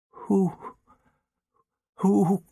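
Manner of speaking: scared, broken-up question; frightened faltering question. A narrator's reading. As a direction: falter in amazement and fear. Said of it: "she whispered".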